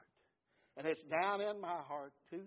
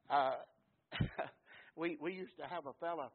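ordinary talk

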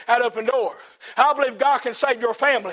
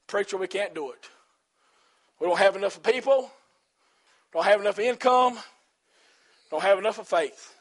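a hint of a timid, defeated church member, softer; mock doubter; 5 semitones lower, 4 dB quieter than usual. Preacher voicing doubters in his church, mildly mocking and softer. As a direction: lightly whine out the excuses.

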